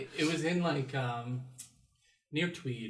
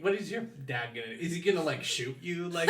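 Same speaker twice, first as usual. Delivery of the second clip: usual speaking voice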